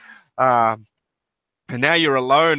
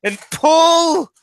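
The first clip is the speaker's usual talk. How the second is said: high, very slow heave; straining heave; 8 semitones higher, slower than usual. A narrator's reading. Game master narrates hauling Trixie ashore, straining heave. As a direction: stretch the words out with effort.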